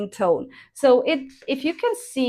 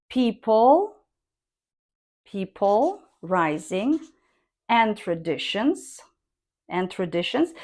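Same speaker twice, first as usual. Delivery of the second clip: people and traditions each said rising; 2 semitones lower than usual. Shown as a demonstration. Teacher drilling rising tone on list words. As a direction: lift each list word.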